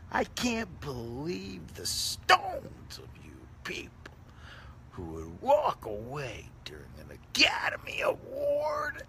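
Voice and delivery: hammed-up outraged whisper; mock whisper. Scolding viewers who left, mock outraged whisper. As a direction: hiss it out in mock disbelief.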